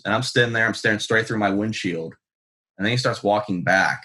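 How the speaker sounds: ordinary delivery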